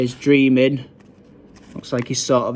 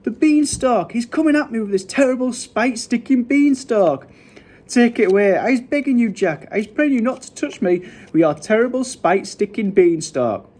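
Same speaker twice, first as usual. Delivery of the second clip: high-pitched frantic begging; screaming; 9 semitones higher than usual. A narrator's reading. Giant begs Jack to spare him, screaming. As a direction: plead in a high, raised voice. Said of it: "screamed the flesh lump eater".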